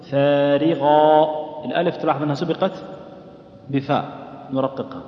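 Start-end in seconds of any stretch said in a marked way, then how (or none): 0.1-1.5 فارغة chanted slowly, thin alif after ف; light alif after ف. Shown as a demonstration. Teacher demonstrating light alif in recitation. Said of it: "نقول".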